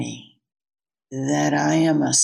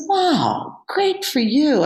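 mock wow; 9 semitones higher than usual. Acting surprised reply to Jimmy.

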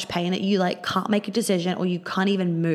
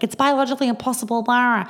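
higher, faster, dismissive mock quote; mocking the media; 5 semitones higher than usual. Mocking the media shutting down her theory, higher and quick. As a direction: parody a smug dismissive expert. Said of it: "the media being like".

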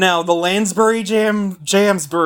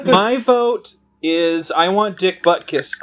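ordinary delivery

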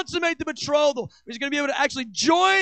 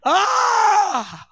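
high, loud anguished cry drawn out slowly; Jesus crying out in longing, high and slow; aching cry; 8 semitones higher, 4 dB louder, much slower than usual. Acted. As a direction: cry out as if the heart burns. Jesus aching to come back.

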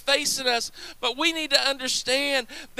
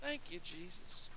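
hushed praise; 7 semitones lower, 20 dB quieter than usual. Real, not acted. Preacher's soft aside of praise.

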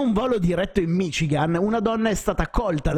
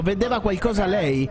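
usual speaking voice

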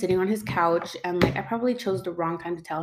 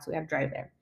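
ordinary talk, nothing marked

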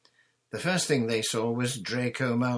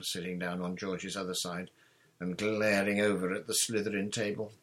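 ordinary delivery, nothing marked

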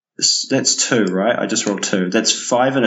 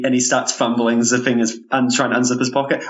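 ordinary delivery

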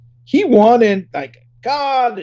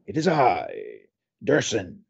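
low villain voice, lower; evil queen; 11 semitones lower, 5 dB quieter, slower than usual. Pretend. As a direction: announce yourself like a villain, low. Villain self-introduction.

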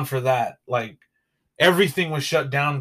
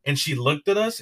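ordinary talk

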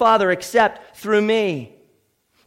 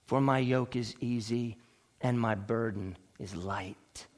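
soft, low, soothing reassurance; tender invitation; 8 semitones lower, 8 dB quieter than usual. Acted. Preacher voicing Jesus to the weary, tender and hushed. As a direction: drop right down and offer comfort gently, with pauses.

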